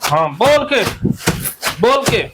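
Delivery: high-pitched, relentless egging-on; fired-up therapy drill leader; heated cathartic incitement. Acted. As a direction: goad them to attack the imagined enemy. Incites group to show no mercy.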